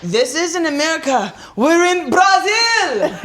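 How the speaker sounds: classic Vin Diesel. Retold or imitated. Vin Diesel facing down Hobbs, lightly imitated. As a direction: drag out Brazil, lightly mocking. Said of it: "Vin Diesel, classic".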